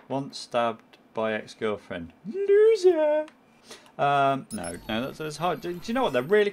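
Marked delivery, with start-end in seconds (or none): 2.3-3.3 high, loud jeering Loser; mock taunt. Gamer mocking the ex-girlfriend victim, high jeering yell. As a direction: shriek it like a playground taunt.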